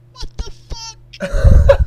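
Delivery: slow, laughing what the fuck; cracking up at the absurd tweet; laughing disbelief. Real, not acted. Reading a crazy tweet to friends, laughing.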